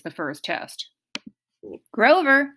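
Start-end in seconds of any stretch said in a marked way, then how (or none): 1.9-2.5 higher-pitched impatient call; testy summons. A narrator's reading. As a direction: snap the name out impatiently. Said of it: "Mister D said impatiently".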